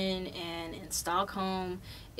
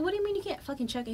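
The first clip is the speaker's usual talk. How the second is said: fast, higher, exasperated demand; annoyed, rushed and pitched up; pissed off retort; 9 semitones higher, much faster than usual. Retold or imitated. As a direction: snap it out in a rush, irritated. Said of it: "fucking pissed".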